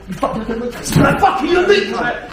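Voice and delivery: loud, high, furious street abuse; aggressive shouting. Acted. Instructor playing a thug, screaming threats at the student. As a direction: bark abuse at him like a thug. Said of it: "What you wanna do is keep your hands up".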